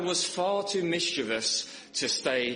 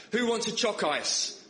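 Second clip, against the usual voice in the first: retold shout; 8 semitones higher than usual. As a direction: call out high, a mild mock shout. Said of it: "they shouted out to us".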